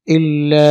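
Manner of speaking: "ইল্লা" with a long, steady drawn-out aa; three-alif stretch. Shown as a demonstration. Teacher demonstrates three-alif madd, "ইল্লা" stretched long. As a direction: hold the final vowel of "ইল্লা" very long. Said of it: "তিন আলিফ টানতে হবে".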